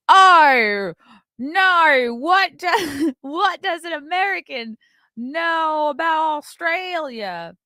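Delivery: high, far-off put-on voice, slowed at the end; hammed-up snooty Australian woman; mocking Australian lady impression. Comic mocking an audience member, high put-on voice. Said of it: "I apologize for that impression".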